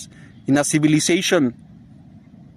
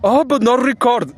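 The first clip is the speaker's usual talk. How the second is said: higher-pitched imitated objection; mocking the other guy; 6 semitones higher than usual. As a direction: mimic his protest, pitched up.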